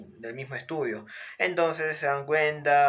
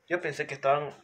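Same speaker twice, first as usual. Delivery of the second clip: ordinary talk, nothing marked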